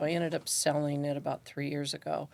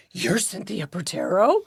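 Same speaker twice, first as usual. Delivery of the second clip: retold surprise; 3 semitones higher, 7 dB louder than usual. Stunned question, retold.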